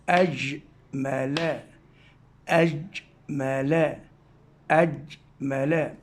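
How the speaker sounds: أجمل drawn out slowly, syllable by syllable; slow syllable reading. Shown as a demonstration. Teacher reading أجمل slowly to third graders. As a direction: read أجمل slowly with the ag sound held. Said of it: "وأكمل كلمة".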